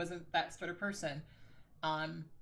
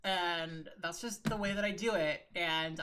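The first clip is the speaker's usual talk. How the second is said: usual speaking voice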